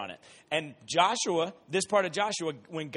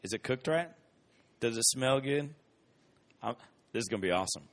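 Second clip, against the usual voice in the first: savouring; 5 semitones lower than usual. Retold or imitated. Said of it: "You're like".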